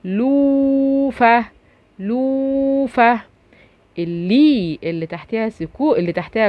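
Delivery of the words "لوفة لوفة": "لوفة" drawn out very slowly; slow demo reading. Shown as a demonstration. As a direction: stretch out "لوفة" for the kids. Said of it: "هنا التالتة لوفة".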